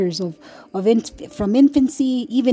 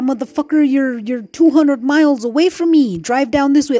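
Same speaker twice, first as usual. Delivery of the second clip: higher, louder furious rant; mother scolding the absent father, angry; 4 semitones higher, 5 dB louder than usual. Real, not acted. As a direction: let the anger burst out at him.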